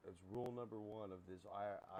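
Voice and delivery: usual speaking voice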